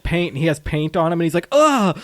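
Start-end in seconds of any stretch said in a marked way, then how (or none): 1.5-1.9 high, loud imitated "ah"; mock dismayed cry. Cop getting wet paint on him, mock dismay.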